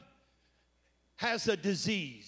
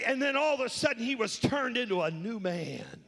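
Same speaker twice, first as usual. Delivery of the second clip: loud, higher, rising preacher's call; full-force preaching; 8 semitones higher, 5 dB louder than usual. Acted. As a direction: drive the scripture home to the church. Preaching climax.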